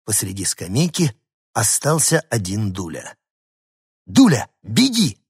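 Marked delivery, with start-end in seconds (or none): 4.1-5.2 higher, loud urgent call; urging the dog to flee; shout. Acted. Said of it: "крикнул я".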